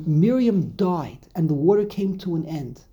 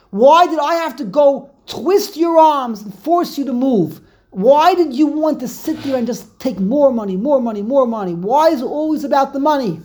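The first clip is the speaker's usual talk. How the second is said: loud, high, building reproach; pent-up resentment; 6 semitones higher, 6 dB louder than usual. Acted. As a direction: let years of frustration burst out. Moshe's pent-up resentment voiced aloud.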